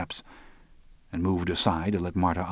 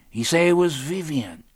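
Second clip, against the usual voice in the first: high-pitched character voice; Lionel's phone voice; 10 semitones higher, 4 dB louder than usual. A narrator's reading.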